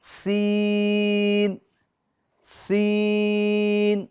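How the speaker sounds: سین held long with a steady hiss; drawn-out seen. Shown as a demonstration. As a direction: stretch سین slowly. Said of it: "بالکل simple حرف ہے یہ". (سین کو لمبا کھینچ کر)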